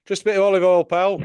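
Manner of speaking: put-on broad Sheffield accent, loud and high. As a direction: do a strong Sheffield bloke. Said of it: "he had a strong Sheffield accent".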